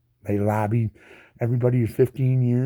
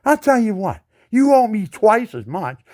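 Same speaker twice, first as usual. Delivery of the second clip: higher, louder defiant challenge; 8 semitones higher, 6 dB louder than usual. Real, not acted. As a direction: stand up to the company. Challenging the phone company.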